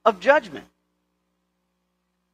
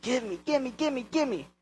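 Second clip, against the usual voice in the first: put-on higher, hushed, insistent kid voice; imitating persistent child; 6 semitones higher, 12 dB quieter than usual. Child pestering a parent for vanilla extract, mock insistent. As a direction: beg over and over like a child. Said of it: "the child is just persistent".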